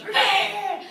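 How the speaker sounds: loud, high imitated bleat of protest; mock kid's wail. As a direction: wail like a thwarted child. Kid wails when kicked off ride.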